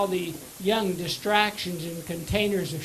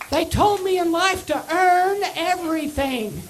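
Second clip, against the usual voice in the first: higher squeaky voice, a bit louder; squeaky voice answering the caller's question; squeaky little voice; 9 semitones higher, 5 dB louder than usual. Retold or imitated. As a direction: squeak it out like a tiny kid. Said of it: "this squeaky little voice".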